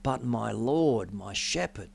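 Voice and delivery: ordinary talk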